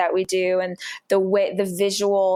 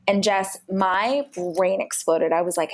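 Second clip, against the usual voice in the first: usual speaking voice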